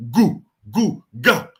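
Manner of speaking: loud mock baby "goo goo ga" from the gut; baby babble. Shown as a demonstration. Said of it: "That's coming from the abdomen".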